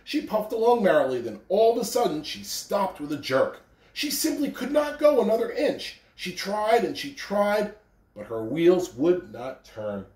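gentle storybook reading; reading to children. A narrator's reading. Principal reading The Little Engine That Could to children, gentle. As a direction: read it like a picture book to kids. Reading a picture book aloud.

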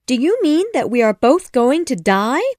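Wendy realizing they may drown; Wendy frightened. A narrator's reading. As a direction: ask in disbelief and fear.

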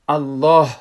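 Allah with an audible breathy final h in "الله"; final h sounded. Shown as a demonstration. Teacher showing students the letter ha, final h sounded on "الله". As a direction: let the air flow out on the last h of "الله". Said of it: "حرف ها هست".

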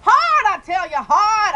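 loud drawn-out complaint; screaming. Real, not acted. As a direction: yell it out, slow and loud. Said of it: "I can scream 'cause I'm outside".

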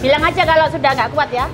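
usual speaking voice

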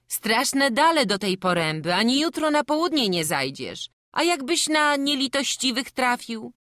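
put-on high, loud calling after the boy; the farmwife calls the leaving boy back with food, high and loud. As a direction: call him back and fuss over him.

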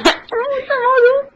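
high, slow squeaky character; cartoon voice. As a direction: stretch it out way up high. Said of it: "One hundred impressions".

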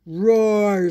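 loud monster roar; deep muffled sea monster. Acted. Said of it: "said the sea monster".

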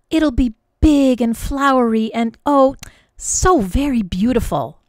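put-on wistful little girl sighing; dreamy sigh. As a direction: dream aloud of the perfect hat. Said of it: "she sighed".

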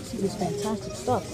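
normal talking voice

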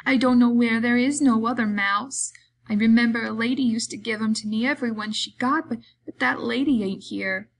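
big simple man protesting sadly; simple-minded Lenny, dejected. Acted. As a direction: argue it plaintively, like an upset child.

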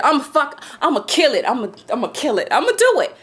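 ordinary talk, nothing marked

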